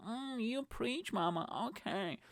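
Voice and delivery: put-on finger-snapping approval; sassy approving woman. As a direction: savour it like an impressed, sassy listener. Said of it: "Z snapping and be like".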